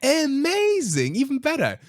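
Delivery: high, slow thrilled exclaim; mock-ecstatic squeal of delight; gushing delight. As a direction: rave about the chocolate, way up high. Excited about no-sugar chocolate.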